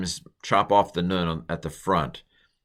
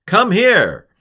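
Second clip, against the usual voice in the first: high, loud call to come over; mock shout across the field; 12 semitones higher, 9 dB louder than usual. Boaz calling Ruth over.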